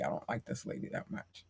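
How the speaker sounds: conspiratorial whisper; hushed gossip; whispered aside. Real, not acted. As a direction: drop to a whisper as if she might hear. Whispered aside about neighbor.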